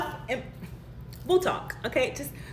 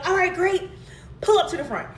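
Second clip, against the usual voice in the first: cheery barista; 9 semitones higher, 4 dB louder than usual. Retold or imitated. Barista's cheery reply, imitated.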